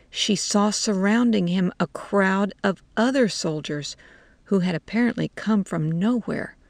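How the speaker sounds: ordinary delivery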